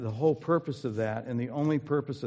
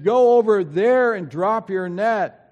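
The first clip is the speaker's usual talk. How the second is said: slow, loud call across the water, higher; called out from shore; 10 semitones higher, 13 dB louder, slower than usual. Retold or imitated. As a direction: shout it out to the boat, slowly. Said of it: "they can shout back and forth".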